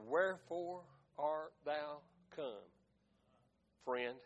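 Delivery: low, soft, measured scripture quote; quiet retold question. Jesus greeting Judas in the garden, quiet low question.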